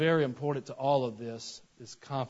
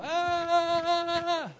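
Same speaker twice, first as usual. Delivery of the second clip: long high comic scream; running yell; 5 dB louder than usual. Retold or imitated. Boy running from the dark with the trash, high drawn-out yell. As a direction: hold a high, wavering 'aah' as if running scared. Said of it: "I would yell".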